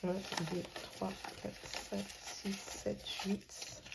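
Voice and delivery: very soft, half-whispered tally; counting under breath. Real, not acted. Vlogger double-checking her five-euro savings, counting under her breath. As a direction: murmur the numbers to yourself as you count.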